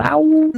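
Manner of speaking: high yowling cry; wolf howl. Acted. Werewolf transformation howl.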